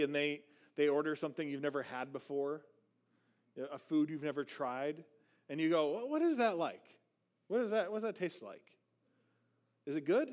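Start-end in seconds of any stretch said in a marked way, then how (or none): 5.9-10.3 higher-pitched, inquisitive questions; eager, curious, pitched up; curious diner. Acted. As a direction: act out a diner quizzing a friend about the food. Diner curious about unfamiliar dish.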